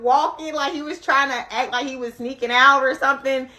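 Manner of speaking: ordinary talk, nothing marked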